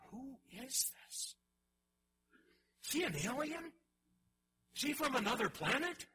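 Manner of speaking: high, astonished questions; bewildered disciples. Retold or imitated. Disciples in the boat asking about Jesus, stunned. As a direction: ask it stunned, unable to make sense of him. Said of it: "the disciples are standing there going".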